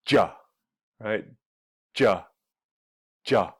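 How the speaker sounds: "ja" as one quick blended syllable for did you; reduced ja. Shown as a demonstration. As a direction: repeat "ja" like a drill. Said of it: "did you comes together and sounds like".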